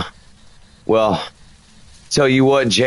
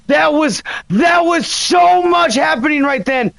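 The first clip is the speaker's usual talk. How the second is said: overwhelmed exclamation; 9 semitones higher than usual. Real, not acted. After sudden ghost activity.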